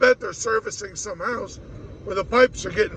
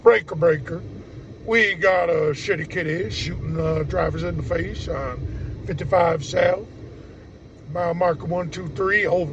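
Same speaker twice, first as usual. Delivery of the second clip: southern accent; 2 semitones lower than usual. Shown as a demonstration. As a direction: put on a southern trucker's accent. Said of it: "you need to do it with a southern accent".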